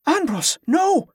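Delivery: high-pitched, urgent cry; alarmed plea. A narrator's reading. Ali trying to stop her brother, alarmed plea.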